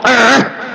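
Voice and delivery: short throaty growl of frustration; frustrated growl. Retold or imitated. Showing how frustration comes out of [name], a growled grunt. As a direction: growl it instead of using words. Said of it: "and I growl".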